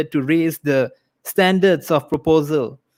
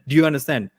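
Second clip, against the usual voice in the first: ordinary delivery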